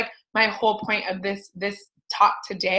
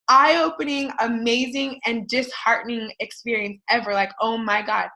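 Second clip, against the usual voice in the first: ordinary talk, nothing marked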